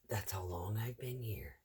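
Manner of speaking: soft breathy whisper under her breath; whispered aside. Real, not acted. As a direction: let it slip out as a whispered aside. Aside about years on job.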